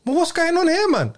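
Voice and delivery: loud, high disbelief at the clip; bursting out in surprised disbelief; high, loud what-is-this; incredulous outburst. Real, not acted. Reacting to a clip.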